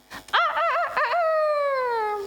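high, long ah-ah-ah like a crowing rooster; rooster crow imitation. Pastor mimicking the rooster from Peter's story, high crowing.

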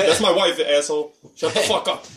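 high, punchy mock outrage; retold indignant retort. Husband answers listeners about his wife, mock-indignant retort. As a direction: snap back in playful indignation.

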